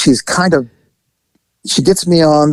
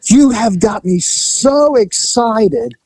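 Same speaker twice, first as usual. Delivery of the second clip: hammed-up excitement; 6 semitones higher than usual. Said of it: "So I go, I go".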